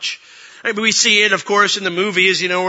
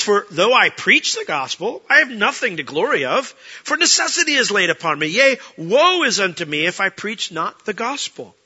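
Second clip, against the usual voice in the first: scripture reading; 3 semitones higher, 4 dB louder than usual. Acted. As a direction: read Paul's words aloud to the congregation.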